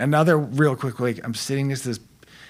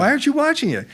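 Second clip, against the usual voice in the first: high-pitched, louder mock bafflement; exasperated parent; 7 dB louder, faster than usual. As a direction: act out a baffled parent's protest. Retelling his exasperation with daughter.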